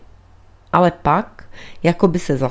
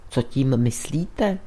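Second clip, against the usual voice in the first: plain reading voice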